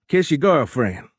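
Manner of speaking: high Cajun captain giving leave; high-pitched, hearty Cajun orders; thick Cajun accent. A narrator's reading. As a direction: send him off in broad Cajun. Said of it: "in a thick Cajun accent".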